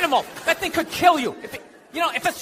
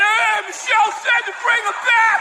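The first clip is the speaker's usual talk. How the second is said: mock shout; 8 semitones higher than usual. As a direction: call out to Michelle, hammed up. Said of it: "Show up with a sharpened mic stand?".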